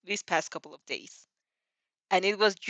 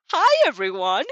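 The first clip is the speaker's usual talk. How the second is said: much louder bright hello; cheerful greeting; 3 semitones higher, 11 dB louder than usual. A narrator's reading. New student greeting the class, cheerful. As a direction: call it out to the whole room.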